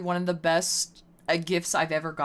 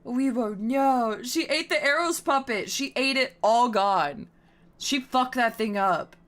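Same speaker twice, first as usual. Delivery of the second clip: mock outrage; 6 semitones higher, slower than usual. Real, not acted. Mock outrage about dog eating puppet.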